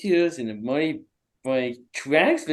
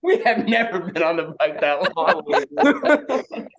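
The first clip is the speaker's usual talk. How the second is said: said through laughter, higher; amused, higher and laughing; 4 semitones higher, 4 dB louder than usual. Real, not acted. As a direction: laugh your way through it, pitch up. Laughing over the long ride.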